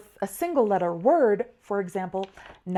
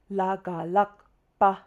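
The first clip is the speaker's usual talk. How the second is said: "La, ga, lak", then "pa" set apart; step-by-step oral spelling; much slower than usual. Shown as a demonstration. Teacher repeating the longer word's spelling, recited step by step. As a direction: recite "La, ga, lakpa" in separate beats. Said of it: "we would say".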